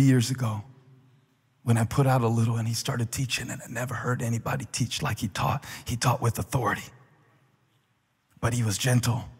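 lightly voiced Peter, hushed and musing; quiet Peter reflecting.

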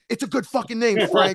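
insulted that the name got mocked; offended alien. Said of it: "And the guy goes".

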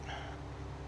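short throat clear; brief clear of the throat. Real, not acted. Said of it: "I've been clearing my throat".

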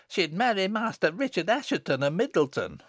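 put-on high child telling tales; knowing. Little girl exposing sister's sweetheart, high knowing voice. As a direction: give away the secret knowingly.

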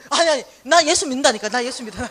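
put-on loud, eager old woman; insistent loud protest. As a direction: insist over and over you believe. Grandma insisting she believes.

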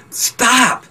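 sharp, high-pitched cry; retold protesting yell. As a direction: imitate a tormented friend yelling in protest. Acts Jeffrey objecting to being tortured.

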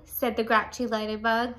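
ordinary delivery, nothing marked

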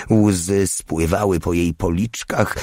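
plain reading voice

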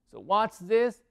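normal talking voice

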